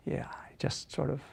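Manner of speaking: breathy words through a yawn; yawning whisper. Shown as a demonstration. Coach shows a lazy yawn, whispered through it. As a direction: whisper it mid-yawn, jaw dropped.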